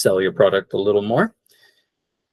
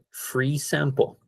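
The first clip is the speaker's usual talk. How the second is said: slow model phrase; 5 semitones higher, 6 dB quieter, slower than usual. Shown as a demonstration. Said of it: "this is what you say".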